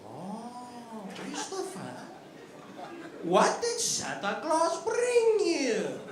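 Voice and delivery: high, very slow put-on dad voice; impression of dad. Dad on Christmas Day quizzing his son, high slow mock voice. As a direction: drag it out with mock sweetness. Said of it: "my dad would be like".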